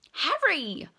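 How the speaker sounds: lightly voiced Fred. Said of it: "said Fred".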